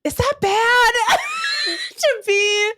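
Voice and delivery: high, laughing near tears; giggling helplessly. Real, not acted. Laughing at own habit.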